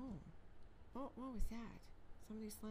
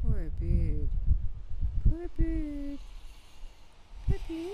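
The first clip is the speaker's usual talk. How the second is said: calling out; 10 dB louder, slower than usual. Real, not acted. Pitying bird with snow on head.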